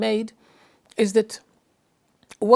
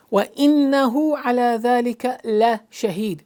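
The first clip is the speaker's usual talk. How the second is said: emphatic وَإِنَّهُ, then an isolated لَ before شَهِيدٌ; stress on إِنَّ and لَ; much faster than usual. Shown as a demonstration. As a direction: lean into the held n of وَإِنَّهُ, pick out لَ. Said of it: "Inna and la".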